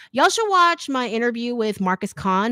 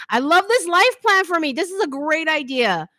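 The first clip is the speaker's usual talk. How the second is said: gushing excitement; 9 semitones higher, 5 dB louder than usual. Real, not acted. Gushing over her own plan.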